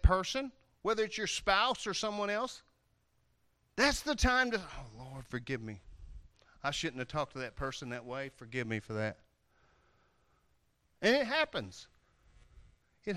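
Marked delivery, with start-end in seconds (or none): 4.6-9.2 much softer and lower, penitent; humble prayer. Acted. Believer asking the Lord's forgiveness, soft and penitent. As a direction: pray it under your breath. Quick prayer after snapping.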